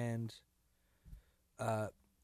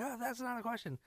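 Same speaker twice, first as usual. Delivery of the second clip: high excited; 11 semitones higher, 4 dB quieter, faster than usual. Retold or imitated. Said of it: "And I was like".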